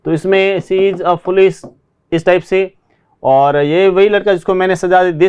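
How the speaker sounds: ordinary talk, nothing marked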